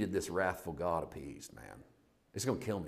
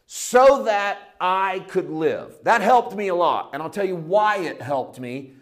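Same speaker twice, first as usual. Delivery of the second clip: much higher, louder fiery preaching; full-force preaching; 7 semitones higher, 11 dB louder than usual. Acted. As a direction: pound the pulpit and build the fire.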